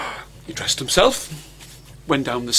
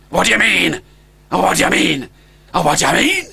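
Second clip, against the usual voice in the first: put-on worried dragon boy; 2 semitones higher, 4 dB louder, faster than usual.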